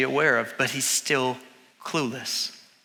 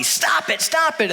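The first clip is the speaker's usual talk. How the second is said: high-pitched acted scolding; exasperated; 10 semitones higher, slower than usual. Balaam beating his balking donkey, exasperated. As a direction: scold sharply, climbing in pitch.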